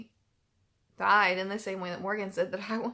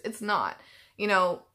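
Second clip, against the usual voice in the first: ordinary talk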